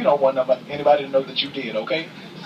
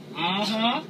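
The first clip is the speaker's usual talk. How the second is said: high mock yes hum, higher; translated hum; 6 semitones higher, 4 dB quieter than usual. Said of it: "I'm gonna translate".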